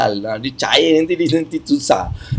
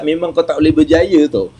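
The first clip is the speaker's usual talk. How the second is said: ordinary delivery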